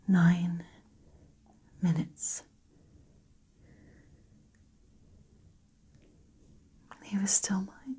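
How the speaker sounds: very soft, halting recollection with long pauses; near-whispered dramatic monologue; grieving Lucy, hushed. Acted. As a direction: barely voice it, reliving the wait for the ambulance. Performs monologue as Lucy, partner dying.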